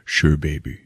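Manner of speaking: low, soft smooth reply; smooth cop. Retold or imitated. Cop answering the blonde, low and smooth. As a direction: purr it like a tough guy. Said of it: "And he's like".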